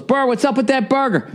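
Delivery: a hint of the impatient cook calling over; chef asking. Chef asking the narrator about the forgotten burger, high puzzled voice. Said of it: "he just goes".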